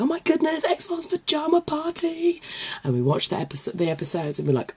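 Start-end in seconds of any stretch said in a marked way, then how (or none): 0.0-2.4 excited squeal. Retold or imitated. Excited fan phoning friends about a party, high mock squeal. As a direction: re-enact squealing down the phone with excitement. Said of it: "I was so excited".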